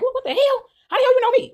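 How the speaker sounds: high-pitched, incredulous outburst; confused disbelief. Retold or imitated. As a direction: act out baffled shock, pitch way up. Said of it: "I'm looking so confused".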